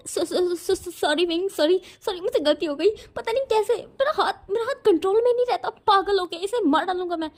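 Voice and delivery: high, stammering sorry with a nervous laugh; flustered, high-pitched excuse-making; flustered apology. Acted. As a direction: stumble over the sorries, laughing in panic. Sheepish apology after muffling friend.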